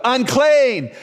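high-pitched cry, each call falling away; yelled warning. Acted. Leper warning healthy people off, yelling out.

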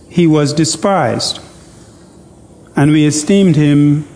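slow, measured Bible reading with long pauses; deliberate pulpit reading of scripture; solemn scripture reading. Acted. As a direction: read Isaiah slowly, letting each phrase sink in.

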